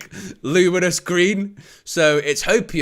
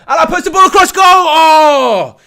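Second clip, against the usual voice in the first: much higher and louder commentator's roar; excited shout; 13 semitones higher, 13 dB louder than usual. Acted. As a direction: scream the near miss like a goal call. Big chance in commentary.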